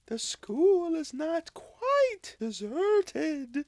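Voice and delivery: high, slow, otherworldly spirit; ghostly spirit voice. Acted. Ghost of Christmas Past solemnly summoning Scrooge, high and slow. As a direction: speak from beyond, high and drawn out.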